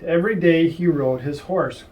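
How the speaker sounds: ordinary delivery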